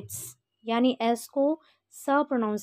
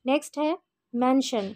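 ordinary talk, nothing marked

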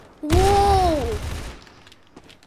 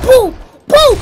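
excited shout; 9 semitones higher, 14 dB louder than usual. Real, not acted. Gamer celebrating a kill.

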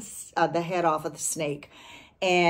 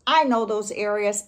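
ordinary talk

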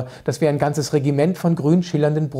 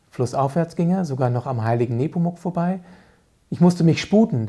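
ordinary delivery

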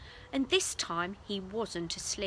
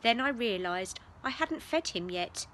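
plain reading voice

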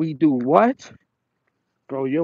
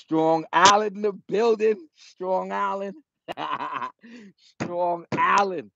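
hype shout-out; 6 semitones higher than usual. Real, not acted. Shout-out to his home area.

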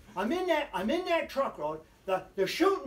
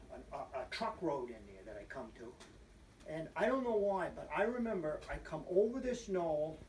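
ordinary delivery

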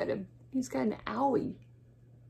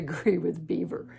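ordinary delivery, nothing marked

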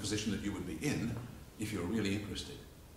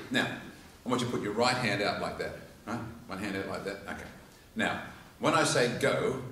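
usual speaking voice

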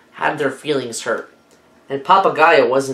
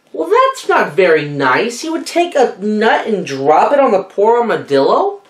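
ordinary delivery, nothing marked

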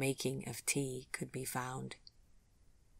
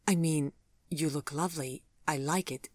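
plain reading voice

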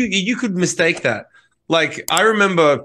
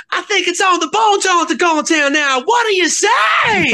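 high frontman hype, stretched final shout; gibberish stage patter; 13 semitones higher than usual. Acted. Announcing the next song, gibberish stage shout. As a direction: slur nonsense like a rock singer working the crowd. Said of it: "I would literally just speak gibberish".

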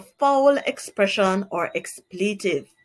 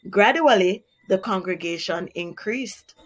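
ordinary delivery, nothing marked

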